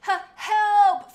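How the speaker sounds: high, loud shout; loud cry for help. Acted. Firestar cries out loudly for help under attack. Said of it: "Firestar meowed loudly".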